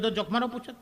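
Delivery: ordinary talk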